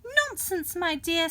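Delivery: high wife voice; soothing her husband, pitched high; giant's wife. A narrator's reading. Wife calming the giant, high voice. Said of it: "said his wife".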